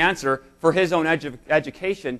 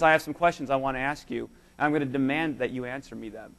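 softer, lower, solemn divine voice; quiet voice of God; 5 semitones lower, 6 dB quieter than usual. Retold or imitated. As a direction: speak as God, hushed and grave. God's voice voiced in sermon.

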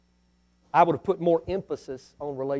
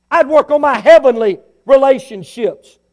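preaching shout; 6 semitones higher, 11 dB louder than usual. Real, not acted. Preaching peak.

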